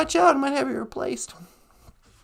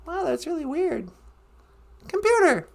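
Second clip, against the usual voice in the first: ordinary talk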